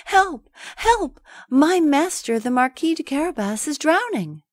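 high frantic cry for help; shouting for help. Acted. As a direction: scream it as if in panic.

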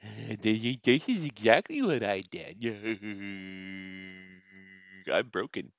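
imitating Greg. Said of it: "He'll be like".

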